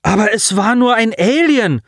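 high, loud pleading call after her; boy shouting his defence at a girl walking away; calling out in protest. Acted. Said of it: "rief Colin ihr nach".

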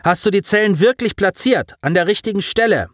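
high, urgent questioning; yelled question. A narrator's reading. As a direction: press the boy for an answer. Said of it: "brüllte er".

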